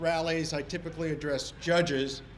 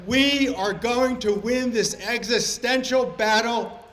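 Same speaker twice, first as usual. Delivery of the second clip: building to a loud, higher shout; shouting at the crowd; 4 semitones higher, 6 dB louder than usual. Real, not acted. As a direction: rise into a rousing shout. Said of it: "today I'm here to shout at you".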